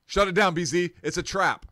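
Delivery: high, loud warning shout; mock alarm. Warning a co-host off the camera, mock-alarmed shout. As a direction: shout it like a sudden ambush.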